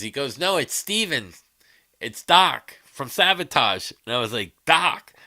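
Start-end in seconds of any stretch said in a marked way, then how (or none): 0.4-3.9 slowly spelling it out, a bit higher; slow emphatic correction. Retold or imitated. Friend calls to correct the mix-up, slow and emphatic. 4.7-5.1 loud startled shout of the name; surprised. Retold or imitated. Realizing the caller is an old bandmate, startled loud shout. Stunned reaction on the phone.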